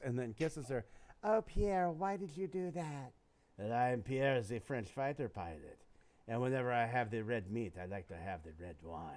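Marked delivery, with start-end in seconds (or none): 1.2-3.1 hammed-up breathless lady, pitched higher; a lightly voiced lover gasping at her man; mock swooning woman. Joke teller plays the woman asking Pierre, mock swoon. 3.6-9.0 low, suave mock French accent, sinking lower at the end; hammed-up French accent. Joke teller voices Pierre answering the woman, mock French accent. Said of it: "I'm gonna leave you guys with a French joke".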